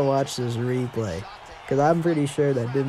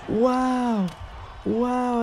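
higher, breathless wow; amazed wow; 8 semitones higher than usual. Real, not acted. Gamer amazed his spinning kick landed, awed.

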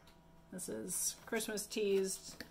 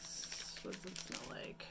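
whispering; 8 dB quieter than usual. Real, not acted. Sniffing the tea quietly.

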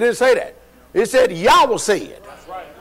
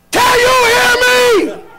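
much higher, much louder full-voice shout; sudden shout; 7 dB louder than usual. Shown as a demonstration. Preacher demonstrating to the congregation, shouting loud. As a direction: bellow it out to the back pews. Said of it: "All right, watch this".

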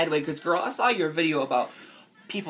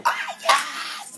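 much higher and louder excited yelp; excited yell; 12 semitones higher, 4 dB louder than usual. Retold or imitated. Fan spotting a new upload, shrieking with joy. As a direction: squeal it like a thrilled fan. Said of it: "I get all excited".

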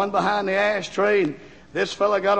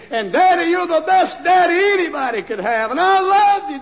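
much higher, louder, tender-pleading; heartfelt plea; 9 semitones higher, 4 dB louder than usual. Retold or imitated. Daughter writing to her father, high emotional plea. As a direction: plead with love. Daughter's love letter read aloud.

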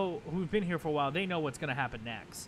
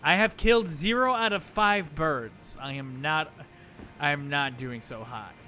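loud frustrated; 9 dB louder than usual. Real, not acted. Reporting failure, loud.

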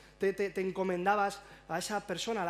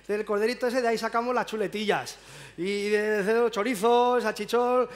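lightly voiced blunt villager, louder; village local; 6 dB louder than usual. Villager telling playing children about the meat, loud mock gusto. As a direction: list the meats with loud relish. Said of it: "viene alguien del pueblo y dice".

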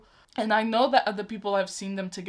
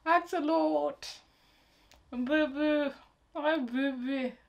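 cooing; 6 semitones higher, slower than usual. Real, not acted. Fan greeting the baby on screen, cooing. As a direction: coo it like greeting a little pet.

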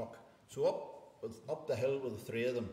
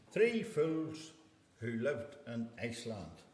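ordinary delivery, nothing marked

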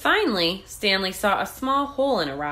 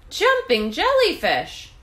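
high squeal of delight; 10 semitones higher, 3 dB louder than usual. A narrator's reading. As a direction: squeal it out in joy. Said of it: "he squealed".

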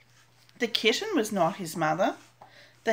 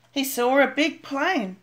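usual speaking voice

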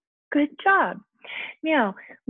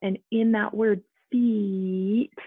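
feet drawn out slowly, stretching the long E; slow emphasis; much slower than usual. Shown as a demonstration. Teacher stressing the long vowel, very slow. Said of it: "long E says E".

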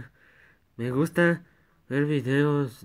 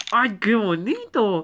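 high cooing; 8 semitones higher, 3 dB louder, slower than usual. Acted. Cooing over a cute creature.

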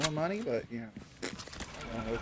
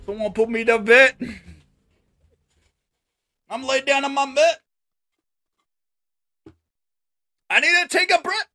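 high whiny; 9 semitones higher, 5 dB louder, faster than usual. Real, not acted. Dramatic after dying.

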